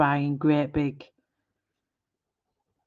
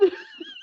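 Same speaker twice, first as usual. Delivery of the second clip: very high, tearful laugh; crying laugh; 6 dB quieter than usual. Real, not acted. Streamer squeaks with laughter recalling the video.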